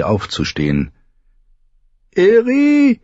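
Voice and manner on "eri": high, louder call for her maid. A narrator's reading. Feverish Dany calls her maids, high and louder. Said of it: "rief sie".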